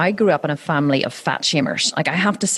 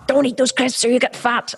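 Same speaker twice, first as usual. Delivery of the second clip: scolding mum; 8 semitones higher than usual. Retold or imitated. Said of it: "I really have wanted to say".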